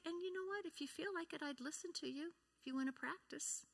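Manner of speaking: soft, gentle offer to help. Shown as a demonstration. Parent offers scared child help, soft and gentle. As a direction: softly invite the child to practice. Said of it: "maybe you can say".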